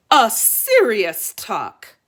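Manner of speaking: slow, grave queen voice; serious queen. Acted. Queen pressing her husband, slow grave queen voice. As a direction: drag out each word gravely. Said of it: "she told her husband".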